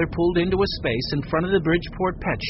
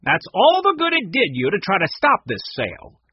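louder, higher angry gloat; acted cross taunt; growling; 7 semitones higher, 5 dB louder than usual. A narrator's reading. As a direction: throw it nastily at the loser. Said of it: "Mac growled".

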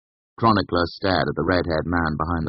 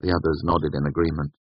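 plain reading voice

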